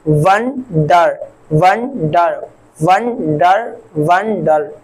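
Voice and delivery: वंडर split into van, then dar; teacher breaking वंडर as van... dar; syllable by syllable. Shown as a demonstration. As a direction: sound out वंडर in two separate beats. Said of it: "इसके तीसरे example की".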